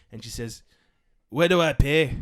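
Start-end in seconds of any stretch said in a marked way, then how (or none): 1.3-2.1 loud Scottish lady's question; Scottish accent. Acted. Scottish customer asks a shop worker, in Scottish accent. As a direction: put on a Scottish accent, louder. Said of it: "this Scottish lady walks up to me".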